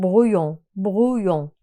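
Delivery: brouillon said as bru-yon, the ll as a y sound; French ll as y. Shown as a demonstration. Teacher modelling brouillon as bru-yon for students. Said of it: "okunuşunu yazdım buraya".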